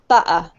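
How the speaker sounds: butter said London-style, BU'-uh; glottal stop. Shown as a demonstration. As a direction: drop the T in butter for a catch in the throat. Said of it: "a glottal stop".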